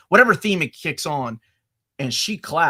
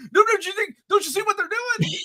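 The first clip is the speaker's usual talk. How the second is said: much higher, urgent pleading; frantic explaining. Retold or imitated. Explaining the reveal to his wife, high and frantic. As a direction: beg her to see it too. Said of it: "And I'm like".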